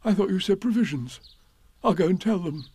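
cheerful high-pitched bear voice; mildly pleased storybook bear; happy. A narrator's reading. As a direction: say it happily as a simple bear. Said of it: "said Pooh happily".

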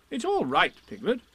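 Rabbit. A narrator's reading. Rabbit comforting Piglet.

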